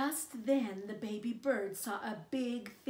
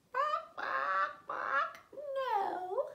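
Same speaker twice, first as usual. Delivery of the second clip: much higher quacking bird voice; hen; 5 dB louder, much slower than usual. Acted. Hen answering the baby bird, high quacking bird voice. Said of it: "said the hen".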